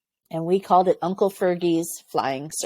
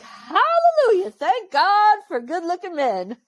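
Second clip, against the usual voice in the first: soaring much higher, joyful hallelujah; excited cry; 11 semitones higher than usual. Retold or imitated. Given Cadbury chocolate by a soldier, overjoyed. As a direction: sing it out like a grateful prayer. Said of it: "I was so excited".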